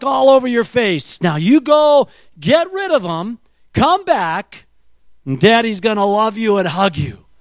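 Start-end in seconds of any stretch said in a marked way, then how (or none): none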